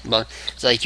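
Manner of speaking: normal talking voice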